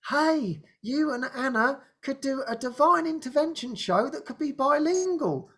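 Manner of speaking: high-pitched pitch of an idea; bright suggestion. Retold or imitated. David proposing a bilingual show, high eager suggestion.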